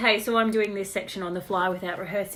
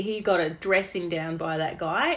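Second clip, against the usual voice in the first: normal talking voice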